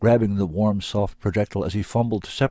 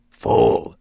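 a hissed, unvoiced snarl of a beast; louder beast snarl; growled; 8 dB louder than usual. A narrator's reading. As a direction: spit the word like an angry beast. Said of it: "it growled".

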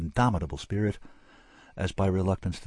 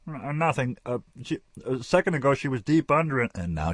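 flustered physician; 8 semitones higher, 3 dB louder than usual. A narrator's reading. Flustered physician caught napping.

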